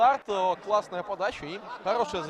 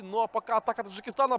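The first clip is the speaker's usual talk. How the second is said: usual speaking voice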